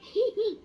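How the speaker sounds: loud short laugh. Real, not acted. Companion laughs at warning.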